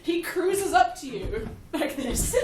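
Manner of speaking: slow, laughing through it. Real, not acted. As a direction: draw it out while laughing. Mimes the pilot's approach.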